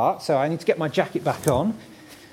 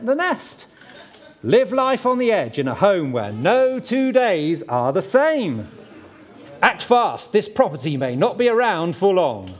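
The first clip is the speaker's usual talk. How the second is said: oversell a crumbling house, full of cheer; gushing real estate agent. Pretend. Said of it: "do my best estate agent impression".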